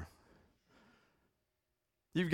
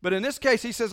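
normal talking voice

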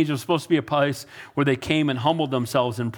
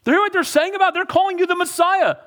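high, loud scandalized priest voice; outraged religious leader; 4 dB louder than usual. Retold or imitated. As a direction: mimic the priest sputtering with outrage. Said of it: "the religious leader's like".

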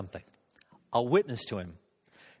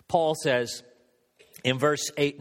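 ordinary delivery

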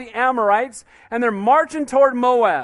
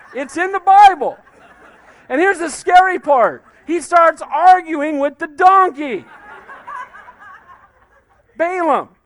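higher and louder through real laughter; preacher laughing at the donkey story, higher and louder; 7 semitones higher, 6 dB louder than usual. Real, not acted. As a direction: chuckle through the punchline.

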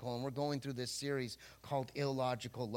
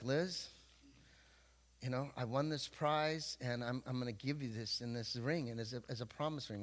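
put-on softer, tender promise to his girlfriend; 4 semitones lower, 7 dB quieter than usual. Young man promising his girlfriend a ring, softly tender. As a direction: hand her the ring gently. Retelling tender proposal to wife.

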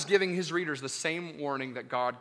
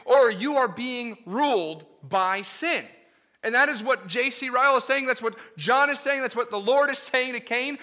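raised, urgent preacher voice; full-force preaching; 6 semitones higher, 6 dB louder than usual. Acted. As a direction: drive the point home hard from the pulpit.

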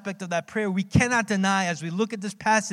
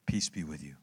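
much lower and softer, a hammed-up saintly calm; mock gentle blessing; 13 semitones lower, 8 dB quieter than usual. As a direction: play the absurdly calm friend. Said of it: "be like".

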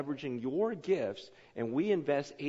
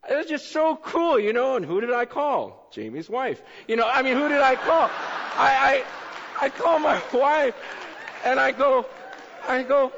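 high, loud and bursting with excitement; can barely contain the joy; excited, almost shouting the story; thrilled; 11 semitones higher, 7 dB louder than usual. Real, not acted. Thrilled retelling of answered prayer.

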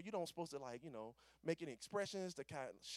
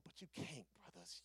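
ordinary talk

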